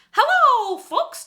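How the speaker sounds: very high, loud cartoon mouse squeak; Mickey Mouse voice. Shown as a demonstration. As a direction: falsetto cartoon mouse hello. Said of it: "kicks you back into gear".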